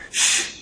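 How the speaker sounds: long voiceless shush; hushing shh. Retold or imitated. Pastor silencing little Jackie, a conspiratorial shush. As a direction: cut the objection off with a shush. Hushing the boy who objects.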